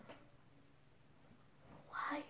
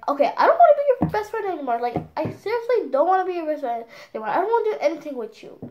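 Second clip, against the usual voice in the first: higher, fast, insistent rush; retold fed-up outburst; 5 semitones higher, 3 dB louder, much faster than usual. Child telling off her ex-best friend, retold fast fed-up outburst. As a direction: act out firmly breaking off a friendship.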